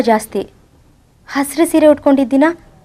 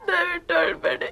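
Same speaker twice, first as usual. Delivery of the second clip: fading plea; 6 semitones higher, 11 dB quieter than usual. Acted. Pleading fades out.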